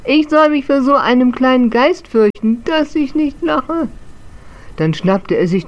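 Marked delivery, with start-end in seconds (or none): 0.0-3.9 high, louder mocking ghost, slowing at the end; scornful amusement. Old ghost mocking the tiny ghost, amused and scornful. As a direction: scoff at the little ghost, drawing out the last words. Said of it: "Das brachte das alte Schlossgespenst so zum Lachen".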